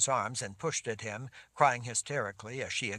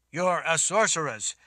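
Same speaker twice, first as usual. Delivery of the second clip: loud, firm declaration; 6 semitones higher, 9 dB louder than usual. Acted. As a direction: lay it down firmly. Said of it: "he said in a firm voice".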